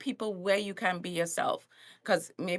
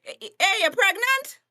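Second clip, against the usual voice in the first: blunt Caribbean greeting; 8 semitones higher, 7 dB louder than usual. Retold or imitated. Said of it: "Caribbean people is like".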